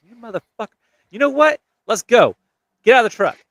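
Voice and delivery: high, loud retold challenge; angry confrontation. Drunk man calling out his friend by the truck, angry.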